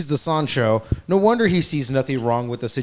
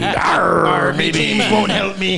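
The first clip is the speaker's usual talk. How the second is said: higher, slow pirate growl of arr; hammed-up old pirate mom; mock pirate lament, slow and high; 6 semitones higher, 4 dB louder, much slower than usual. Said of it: "A K A the pirate".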